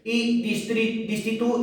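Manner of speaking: ordinary talk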